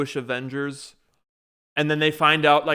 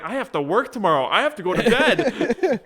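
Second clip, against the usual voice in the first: laughing self-quote; 7 semitones higher, 3 dB louder than usual. Retold or imitated. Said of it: "I'm like".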